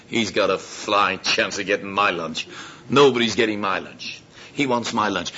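low, grudging mock refusal; indignant lad. Hungry lad refusing to share lunch, mildly indignant. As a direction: lightly play a boy guarding his food.

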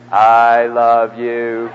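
long, low, drawn-out words; with feeling. Shown as a demonstration. Preacher shows the congregation how to say it, with feeling. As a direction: stretch every word out slowly with heavy feeling. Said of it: "say it with feeling".

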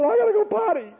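put-on high, squeaky child's whine; little girl voice. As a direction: pitch it way up like a four-year-old.

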